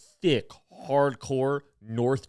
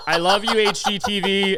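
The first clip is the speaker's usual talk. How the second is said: gushing to the TV; 6 semitones higher, 6 dB louder than usual. Retold or imitated. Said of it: "I was like".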